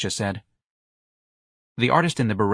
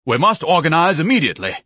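loud, high, emphatic order; rally someone to act at once; urgent command; 9 semitones higher, 7 dB louder than usual. Acted. Ignatius urging action.

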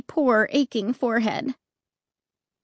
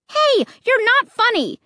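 high, loud angry yell; angry scream; 12 semitones higher, 5 dB louder than usual. Acted. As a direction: scream it at them angrily. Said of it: "I screamed angrily".

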